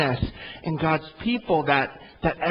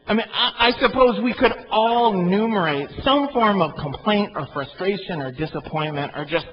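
ordinary talk, nothing marked

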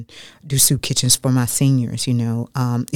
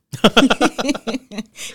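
a high, real laugh breaking out; a sudden high burst of laughter; 10 semitones higher than usual. Real, not acted. Bursts out laughing at own line.

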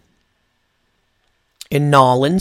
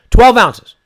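loud, high outrage; outraged; 9 semitones higher, 11 dB louder than usual. Real, not acted. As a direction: shout it in disbelief. Outraged at the water's size.

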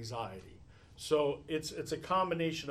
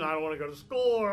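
imitating a child's tantrum yell, louder and fast; mild mock yell; 6 dB louder, faster than usual. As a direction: retell her screaming protest, only half-loud.

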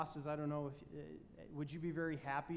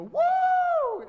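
very high, very loud whoop; mock fan's wild cheer; football fan cheer; 19 dB louder than usual. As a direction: whoop like your team just scored. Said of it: "you stand up and go".

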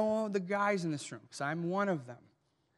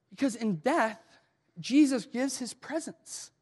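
normal talking voice

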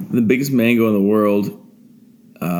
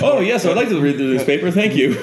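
lightly voiced, higher-pitched courteous spider; polite spider; 8 semitones higher than usual. Spider answering about the newspaper, mock-polite higher voice. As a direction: accept politely in a raised, playful voice. Playing along as meme spider.

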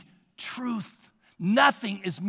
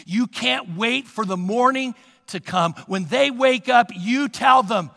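usual speaking voice

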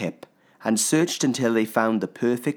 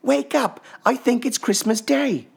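put-on child voice; 5 dB louder than usual. A narrator's reading. Little Pip, eager cub.

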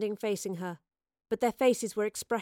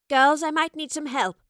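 raised, strained call for help; 6 semitones higher, 7 dB louder than usual. Acted. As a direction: call over the noise to the girls. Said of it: "she called".

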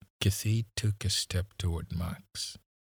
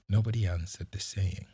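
usual speaking voice

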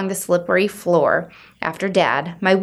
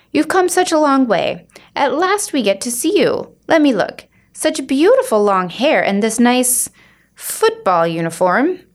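higher, effusive welcome; gushing aunt; 6 semitones higher than usual. Acted. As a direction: gush over the niece. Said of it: "the woman in purple".

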